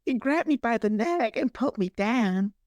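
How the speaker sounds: very high mock Southern belle; hammed-up damsel, high voice held through; Alice. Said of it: "She doesn't speak like that".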